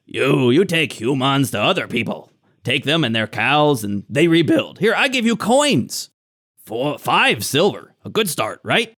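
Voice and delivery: halting broken-speech goblin offer; bargaining hobgoblin. Acted. Hobgoblin offering silver to escort the humans away, ingratiating. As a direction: coax them as a shifty hobgoblin.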